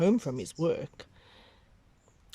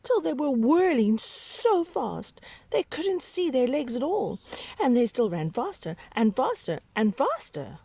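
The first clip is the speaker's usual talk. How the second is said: rising higher and louder as they whirl; building excitement; 9 semitones higher, 4 dB louder than usual. A narrator's reading. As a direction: speed it up, higher and louder each time. Tigers whirling faster.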